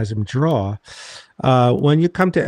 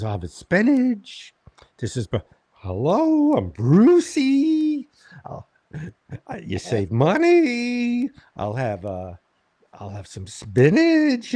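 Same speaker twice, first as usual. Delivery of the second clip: much higher, slow, silly voice of a cheap comic; mocking Bruce; 7 semitones higher, slower than usual. Comedian mocking freeloading Bruce ordering food, high slow silly voice. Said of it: "He goes".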